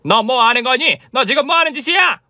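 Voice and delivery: put-on mother-in-law shrieking at daughter-in-law holding the baby; sudden shriek. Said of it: "소리를 빽 지르시는 거예요".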